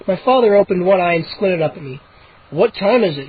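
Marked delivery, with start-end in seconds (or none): none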